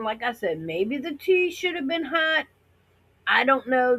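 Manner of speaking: ordinary talk, nothing marked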